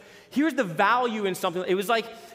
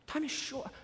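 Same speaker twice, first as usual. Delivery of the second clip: hushed; 5 semitones higher, 11 dB quieter than usual. Real, not acted. Hushed repeat of warning.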